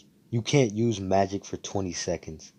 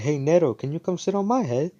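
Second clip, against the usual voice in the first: calling out; 9 semitones higher, 5 dB louder than usual. Retold or imitated. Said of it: "She was like".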